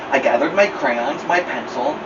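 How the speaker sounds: usual speaking voice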